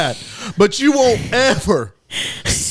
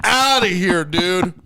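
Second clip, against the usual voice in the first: higher, hammed-up rant against shirt wearers; mock rant; 5 semitones higher than usual. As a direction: play it as a half-joking tirade, pitched up.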